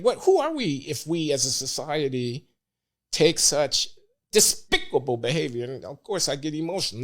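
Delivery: ordinary delivery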